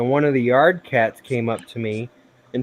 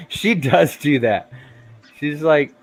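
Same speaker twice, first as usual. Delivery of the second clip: ordinary talk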